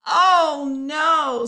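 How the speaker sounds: loud dismayed cry; loud comic oh no. Acted. Monkeys learn the wrong day.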